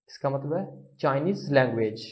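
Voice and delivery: usual speaking voice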